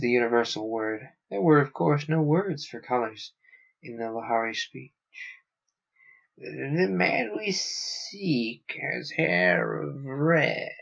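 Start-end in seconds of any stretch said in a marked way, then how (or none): 6.4-10.7 very slow whispery alien question. Acted. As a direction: drag it out, cold and probing. Alien guard speaks.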